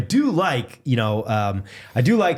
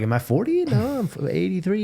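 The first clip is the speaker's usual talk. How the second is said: mock confusion; 8 semitones higher than usual. Teasing the co-host about his age, mock confused. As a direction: pitch jumps way up in mock panic over his age. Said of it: "joking about forgetting his age".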